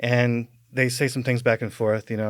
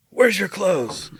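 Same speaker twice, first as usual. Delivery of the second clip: slow police officer; 8 semitones higher, much slower than usual. Retold or imitated. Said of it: "he's like".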